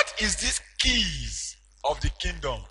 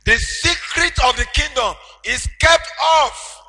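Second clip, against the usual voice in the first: loud, higher preaching surge; preaching at full force; 8 semitones higher, 8 dB louder than usual. Acted. Preacher telling congregation of Peter, in full preaching cry. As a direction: lift the church with it.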